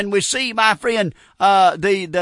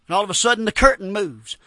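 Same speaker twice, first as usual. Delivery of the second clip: normal talking voice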